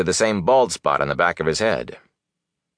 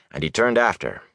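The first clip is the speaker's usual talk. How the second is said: usual speaking voice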